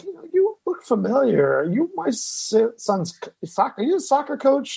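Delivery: lightly voiced nosy stranger, groping for a memory; plane seatmate. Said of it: "he's like".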